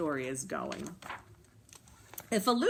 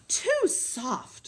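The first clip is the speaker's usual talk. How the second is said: breathless complaint; 6 semitones higher, 4 dB louder than usual. Acted. Aluki gasping.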